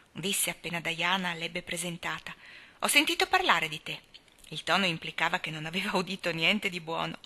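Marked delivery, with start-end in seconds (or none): 2.8-4.0 a hint of a sour old woman, higher and louder; grumpy old aunt. A narrator's reading. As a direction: sniff at the girl like a cranky old aunt. Old aunt voiced by narrator. (la zia scorbutica accoglie Anna con sospetto, tono acido)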